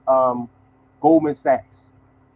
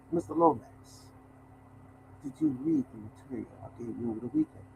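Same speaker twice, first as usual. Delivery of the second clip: Dracula voice; 7 semitones lower, 13 dB quieter than usual. Retold or imitated. Vice president greeting the mailman, in a hushed Dracula voice. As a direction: lean in and purr it like a count. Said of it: "Told you he talked like Dracula".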